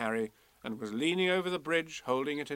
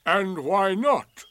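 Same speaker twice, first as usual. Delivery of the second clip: loud, slow, raised retort; Eeyore, cold; 6 semitones higher, 10 dB louder, much slower than usual. A narrator's reading. Donkey answering the impressed youngster, loud and cold. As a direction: answer coldly, with deliberate weight. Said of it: "said Eeyore coldly".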